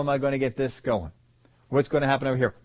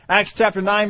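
normal talking voice

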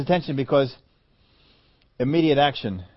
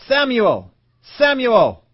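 high, louder call of a name; 8 semitones higher, 6 dB louder, much slower than usual. Acted. God calling young Samuel in the night, loud. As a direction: call the boy's name twice.

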